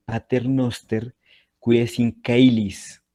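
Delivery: "caelis" said as "keilis", hard k, slow; classical Latin. Shown as a demonstration. Teacher demonstrating the classical pronunciation to students. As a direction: Pater noster qui es in caelis with classical k sounds, drawn out. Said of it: "en el latín clásico sonaría".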